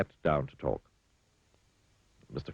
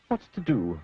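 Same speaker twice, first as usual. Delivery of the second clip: ordinary delivery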